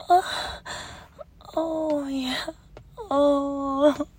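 drawn-out breathy pleasure moans; moaning. Shown as a demonstration. Showing a lover how they moan, breathy moaning. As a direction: moan slowly, letting each one trail into breath. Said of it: "when I moan for you like this".

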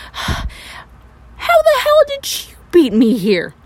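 angry. Acted. Confronting her clone.

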